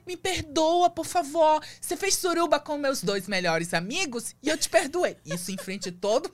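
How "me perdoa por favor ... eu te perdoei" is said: much higher, louder mock pleading; hammed-up begging for forgiveness; half-mocking diva plea; dramatic plea. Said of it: "Eu falei".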